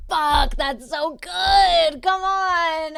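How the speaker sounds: slow, loud delighted drawl; drawn-out amused exclamation; delighted groan. Real, not acted. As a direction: savor how good the comeback is. Reacting to a funny reply.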